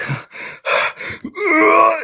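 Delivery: high-pitched squeal of laughter; helpless high laugh. Real, not acted. Laughing fit mid-story.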